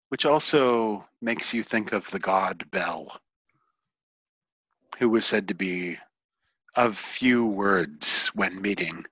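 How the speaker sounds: soft game master narration with long pauses; hushed scene setting. Acted. Game master describing a shadowed figure to players, hushed narration.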